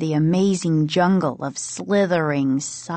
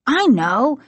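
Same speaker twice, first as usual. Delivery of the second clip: higher-pitched, sharp comeback; sharp retort; 6 semitones higher, 4 dB louder than usual. A narrator's reading. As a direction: answer sharply, a touch irritated. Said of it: "he said sharply".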